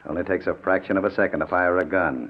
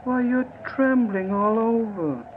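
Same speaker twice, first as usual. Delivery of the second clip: much higher, slow sneering comfort; mock soothing; 12 semitones higher, slower than usual. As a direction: fake tenderness, dripping scorn. Kidnapper mocks trembling victim.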